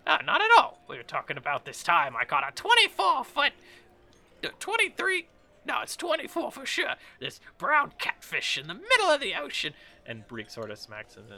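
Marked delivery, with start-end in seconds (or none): 0.1-9.7 high-pitched fish-story character voice; boastful little guy. Acted. Little guy telling his catfish story, high and boastful. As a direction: brag in a high put-on accent.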